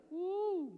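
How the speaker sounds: high-pitched "woo"; high whoop. Real, not acted. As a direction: let out a drawn-out high whoop. Preacher exclaims before his point.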